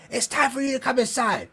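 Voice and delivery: put-on old lady scolding the drinkers to come inside; mad old lady. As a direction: nag like an annoyed aunt calling the kids in. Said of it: "Old ladies start getting mad".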